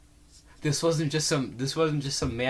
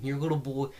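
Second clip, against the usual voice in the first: normal talking voice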